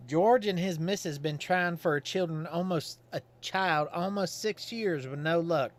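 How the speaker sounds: put-on folksy old Godfrey. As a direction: play him as a simple, cheerful Southern townsman.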